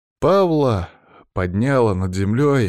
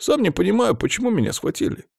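high-pitched, sobbing villain's plea; pitiful high whine, acted; whimpering; 13 semitones higher than usual. A narrator's reading. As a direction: whimper it like a frightened victim. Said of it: "всхлипывал тот злодей".

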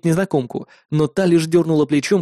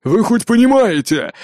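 angry; 7 semitones higher, 3 dB louder than usual. A narrator's reading. Wounded lion captain shouts.

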